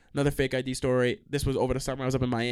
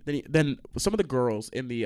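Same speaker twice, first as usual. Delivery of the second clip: ordinary talk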